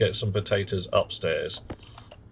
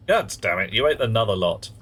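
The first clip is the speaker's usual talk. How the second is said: usual speaking voice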